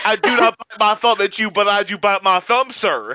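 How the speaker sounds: mock-indignant southern comeback; thick southern accent. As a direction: answer the challenge, thickly southern. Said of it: "thick Southern accent".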